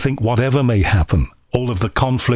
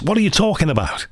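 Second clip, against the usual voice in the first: higher-pitched mock-annoyed Liverpool accent; annoyed Scouse; 6 semitones higher than usual. Host showing how the accent returns when annoyed, irritated Scouse. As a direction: lapse into Scouse as if someone has irked you. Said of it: "when I get annoyed or somebody annoys me".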